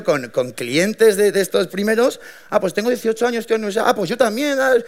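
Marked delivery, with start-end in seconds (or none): 2.5-4.7 higher, light chatty voice; lightly voiced eager teen; friendly small talk. First customer chatting with the founder about university, light high chatter.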